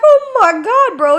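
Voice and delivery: high, loud disbelief; exasperated shout. Retold or imitated. To Jason in the Uber, a high loud exasperated yell.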